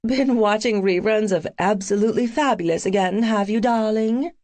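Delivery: put-on British accent, playful; best British accent. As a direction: tease in an arch British accent. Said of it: "in my best British accent".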